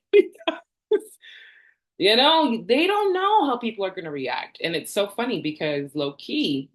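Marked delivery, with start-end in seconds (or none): 0.1-4.6 squealing laugh running into high words; laughing, pitched way up. Real, not acted.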